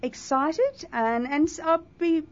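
bubbling with excitement; excited. Real, not acted. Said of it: "I'm actually excited".

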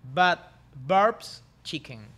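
very slow careful English; slow English reading. Shown as a demonstration. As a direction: drag each English word out. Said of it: "te la voy a decir otra vez".

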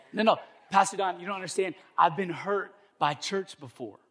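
acted complaint, pushed up; mock protest. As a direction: plead to be understood, imitating a churchgoer. Imitates hurt churchgoer's objection.

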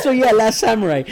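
laughing high through the words. Real, not acted. Laughing after joke.

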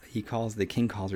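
ordinary delivery

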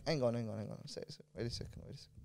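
low, quiet mutter to oneself; muttered second thoughts. Acted. An onlooker looking at it and thinking hang on, muttered. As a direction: trail down into a hushed, puzzled murmur. Voices someone pausing to rethink.

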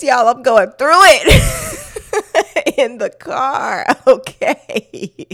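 loud, high, drawn-out cry through laughter; laughing exclamation. Real, not acted. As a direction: laugh at your own breakdown as you wail it out. Laughs at own retold breakdown.

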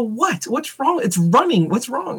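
mock baffled what's wrong; puzzled questions. Husband imitating his confused reply to wife's honking.

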